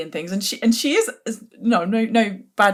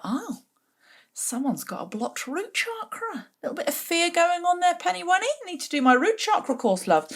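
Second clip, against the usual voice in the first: mock sweet sarcasm; 11 semitones higher than usual. Inner retort to Penny.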